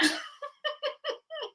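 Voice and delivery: high, soft run of giggles; amused giggle. Real, not acted. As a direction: giggle quietly at your own admission. Laughs about unmounted shelves.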